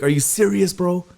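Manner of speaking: high-pitched mock disbelief; incredulous manager. Host acting the manager confronting staff, incredulous. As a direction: imitate someone who can't believe it.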